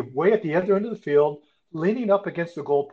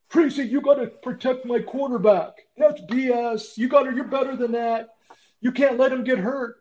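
mock sideline yell, pitched much higher; a coach chewing out the referee, half-joking; retold coach tirade; 10 semitones higher than usual. Coach scolds the referee over his quarterback, mock high yell.

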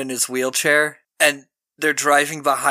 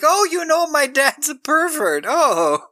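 high, slow squeaky take on Kirk; mock Kirk; 13 semitones higher than usual. Friend retelling a horror film mocks Kirk in a high, slow voice. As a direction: play him as a wounded, high-pitched dope. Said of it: "Kirk's like".

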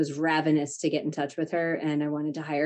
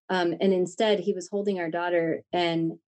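normal talking voice

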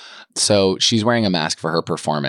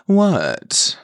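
high, loud mock "what?"; exaggerated high-pitched innocent query; imitating clueless actress; 13 semitones higher, 5 dB louder than usual. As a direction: mimic her with a high pitch that drops away. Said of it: "she just kept going".

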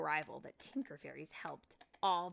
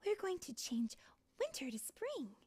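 chiming in brightly; 12 semitones higher than usual. Acted. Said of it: "Silver Mist chimed in".

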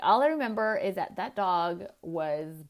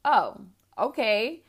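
slow, louder bemused oh okay; puzzled acceptance; 3 semitones higher, 7 dB louder, slower than usual. Retold or imitated. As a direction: play the puzzled kid going along with it. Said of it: "I was like".

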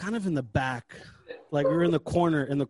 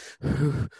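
heavy exhausted puff of air; mock winded exhale; out-of-breath huff. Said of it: "all fucking out of breath".

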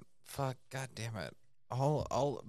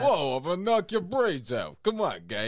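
put-on louder Philly boxer accent, Rocky style; Sylvester Stallone; 3 semitones higher, 5 dB louder than usual. Doing Rocky for his co-host, a louder Philly boxer accent. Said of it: "It's Sylvester Stallone".

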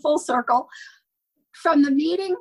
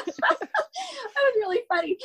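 slow and high, laughing through the words; 10 semitones higher, slower than usual. Real, not acted. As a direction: laugh as you remember it. Laughing at the pass story.

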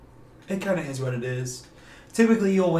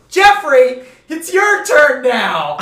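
JEFFREY called out high and loud, then slow; emphatic name call; 9 semitones higher, 11 dB louder, slower than usual. Retold or imitated. Teacher calling the unwilling kid to his lesson, emphatic. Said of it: "I used to say his name really emphatically".

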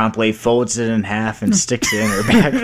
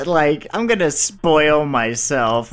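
slow indulgent; 3 semitones higher, much slower than usual. Retold or imitated. Voicing a witch indulging herself.

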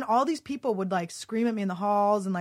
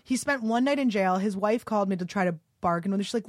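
ordinary talk, nothing marked

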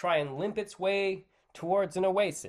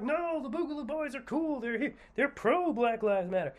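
high, mocking voice of the other side; mocking quote; 11 semitones higher than usual. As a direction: squeak it out like a know-it-all mocking them. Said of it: "other people who are like".

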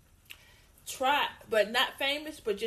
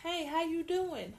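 friendly greeting; 6 semitones higher, slower than usual. Retold or imitated. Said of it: "all it took was just".

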